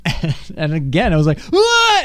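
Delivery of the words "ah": high, loud yelp; retold falling scream. Climber retelling a sudden fall, loud high scream. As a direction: yelp as if losing your grip.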